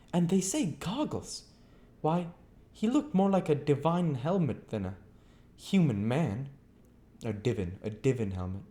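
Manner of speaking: high, chattering landlady voice; lightly rustic landlady gabbling on; Mrs Hall's voice. A narrator's reading. Mrs Hall voiced in story.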